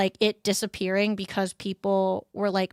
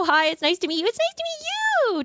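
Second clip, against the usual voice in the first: put-on cute anime girl voice; 12 semitones higher than usual. Streamer greets in cute voice.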